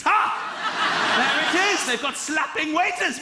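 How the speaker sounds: gleeful whoop. Acted. Gleeful at spotting the bad review.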